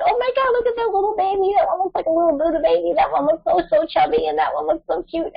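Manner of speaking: put-on high, fast baby-talk gushing; cooing over babies. Mother imitating people cooing at nursery babies, high baby talk. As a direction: coo at the newborns like a doting visitor. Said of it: "you're like".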